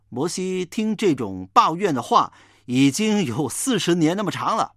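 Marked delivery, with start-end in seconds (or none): none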